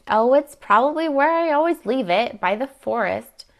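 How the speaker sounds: high, loud retort that eases at the end; defiant retort. Acted. Niece answers aunt about the lost skirt, defiant. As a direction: answer back with exaggerated pitch.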